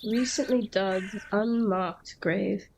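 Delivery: slowly repeating "unmarked grave"; slow, deliberate echo of the game line. Retold or imitated. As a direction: drag out "Recently dug, unmarked grave" slowly. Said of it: "This appears to be a recently dug".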